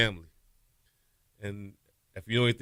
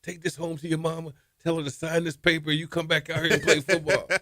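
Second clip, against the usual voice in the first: raised, higher coach voice; imitating the coach; 6 semitones higher than usual. Coach telling the kid to bring a note home, raised voice. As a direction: play the coach giving orders. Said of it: "He's like".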